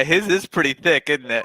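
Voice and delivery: ordinary talk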